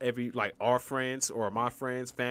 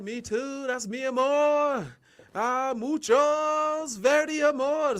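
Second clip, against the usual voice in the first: mock Spanish lover; 12 semitones higher, 4 dB louder, much slower than usual. Fake Spanish to sisters.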